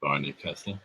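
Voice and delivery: low, hushed conspiratorial murmur; very soft, low, secretive. Retold or imitated. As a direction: confide the name like a secret password. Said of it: "in a sort of conspiratorial tone of voice".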